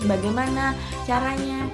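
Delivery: ordinary delivery, nothing marked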